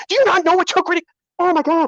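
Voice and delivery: high, incredulous outburst; outraged disbelief. Real, not acted. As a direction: exclaim it high, appalled. Outraged at what she read.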